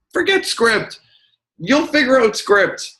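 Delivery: high-pitched mock outburst; acted, high exasperated retort; exasperated dismissal. Acted exasperated answer to clients.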